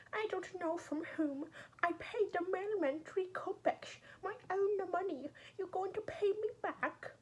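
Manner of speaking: the maid Natasha, teasing and alarmed, pitched high; high maid's voice. Acted. Natasha the maid fussing at Raskolnikov, high teasing voice. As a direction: play the chatty maid, voice lifted well above your own.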